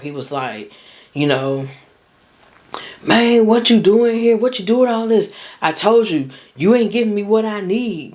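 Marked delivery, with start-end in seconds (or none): 3.0-8.1 replaying his yelling, pitched up; retold shouting. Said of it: "I heard him shouting at her".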